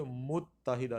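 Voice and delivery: متحدہ slowly as mut-ta-hida; correct pronunciation. Shown as a demonstration. Teacher gives the right word, slow careful pronunciation. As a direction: hold the double t in متحدہ. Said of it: "ہم اس کو کہیں گے".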